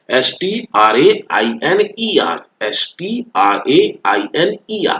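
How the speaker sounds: letters spelt out slowly; slow teacher spelling of strainer. Shown as a demonstration. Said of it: "spelling out strainer".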